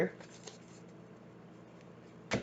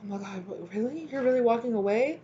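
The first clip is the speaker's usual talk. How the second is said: hushed, high incredulous gasp; stunned disbelief; 4 semitones higher, 4 dB quieter than usual. Retold or imitated. The person shocked you're leaving, hushed and incredulous. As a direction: whisper it in shock. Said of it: "this person's like".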